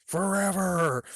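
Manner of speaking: drawn-out haunting wail, rising on the last word; slow spooky mock curse; ghostly threat. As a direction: stretch it like a vengeful ghost. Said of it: "he's a ghost".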